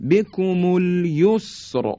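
"بکم الیسر" held slow and high, heavy ر at the end. Shown as a demonstration. Teacher repeating the example, slow heavy ر in "بکم الیسر". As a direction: make the final ر thick in a drawn-out "بکم الیسر".

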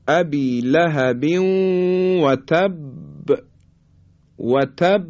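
"تب" held on the doubled ب, then bounced; qalqalah on ب. Shown as a demonstration. Teacher models the letter ba in "تب", bouncing qalqalah. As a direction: recite "ابی لهب و تب" slowly, popping the final ب. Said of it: "این صفت قلقله هست".

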